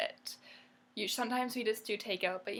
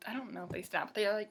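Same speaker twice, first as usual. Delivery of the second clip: ordinary talk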